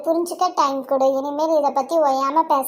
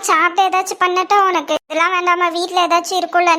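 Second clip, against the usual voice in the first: ordinary talk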